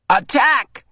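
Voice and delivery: high loud play shout; rally the pretend army; child's attack shout in war game; battle cry. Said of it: "The shout".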